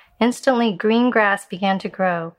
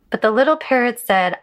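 usual speaking voice

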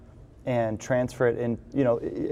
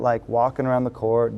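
ordinary talk

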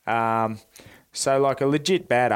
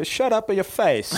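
much higher, put-on rough trainer voice; joking about the trainer cutting off his life story; mock tough trainer; 10 semitones higher than usual. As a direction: bark a joke insult as the trainer. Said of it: "And he's like".

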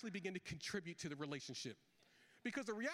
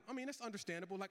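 ordinary talk

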